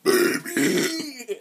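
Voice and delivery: very high squeak of a word; squeaky baby voice. Acted. Sudden squeak after whisper.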